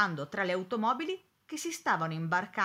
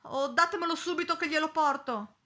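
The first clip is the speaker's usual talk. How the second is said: urgent, higher and louder plea; horrified maid; 8 semitones higher, 5 dB louder than usual. A narrator's reading. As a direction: demand it at once, still shocked. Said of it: "esclamò inorridita".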